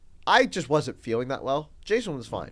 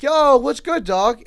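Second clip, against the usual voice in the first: imitating a hype teen; 10 semitones higher, 9 dB louder, slower than usual. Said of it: "he's like".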